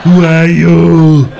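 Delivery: put-on slow, loud Austrian accent; slow, loud, heavy Austrian Arnold question; booming slow Austrian-accented question; Schwarzenegger voice. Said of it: "That voice he uses when he asks a question".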